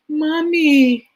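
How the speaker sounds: put-on high, small child's voice asking anxiously; a worried four-year-old tugging at mom; worried little girl. Little daughter worried by her crying mother, high and anxious.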